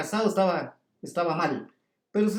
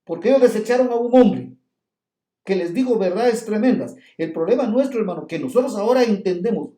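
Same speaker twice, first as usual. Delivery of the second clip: preaching at full force; 5 dB louder, faster than usual. Acted. Preacher urging the congregation on Christ the word, loud.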